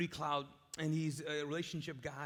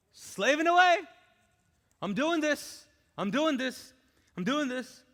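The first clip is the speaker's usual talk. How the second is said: higher, louder, strained complaint; grumbling older son; 9 semitones higher, 11 dB louder than usual. Acted. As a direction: act the son sweating in the field, fed up. Voicing the toiling older son.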